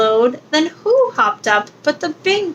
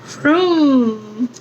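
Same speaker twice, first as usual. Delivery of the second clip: ordinary delivery, nothing marked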